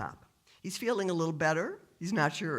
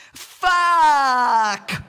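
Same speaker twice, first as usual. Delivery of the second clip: long, high, drawn-out yell; heroine's shout; 10 semitones higher, 15 dB louder than usual. Acted. Biker heroine's first word, a long loud shout. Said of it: "we hear our heroine Linda speak".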